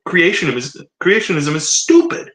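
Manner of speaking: exasperated, high-pitched self-reproach, put on; mock sudden realization. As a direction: act out it suddenly dawning on you. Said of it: "it occurs to you".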